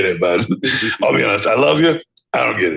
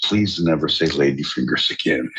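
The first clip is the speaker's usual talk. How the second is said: normal talking voice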